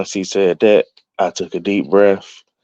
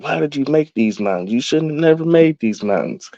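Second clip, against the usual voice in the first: higher-pitched, mock complaining at God; half-joking higher fuss at the mountains; retold fussing; 7 semitones higher, 3 dB quieter than usual. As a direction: fuss like a frustrated trainee who can't take the hill. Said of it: "I just kept fussing".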